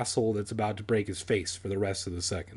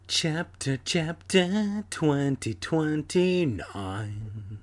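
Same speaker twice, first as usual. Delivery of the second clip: very slow, drawn-out mock announcer; put-on theatrical title voice; chapter announcement; 3 semitones higher, much slower than usual. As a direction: intone the heading, stretching every word.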